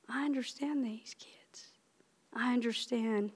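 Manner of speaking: hushed, unvoiced wonder; whispered realisation. Teacher whispering her realisation about her students. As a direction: breathe it out as a quiet discovery.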